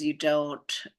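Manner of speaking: usual speaking voice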